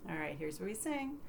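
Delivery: usual speaking voice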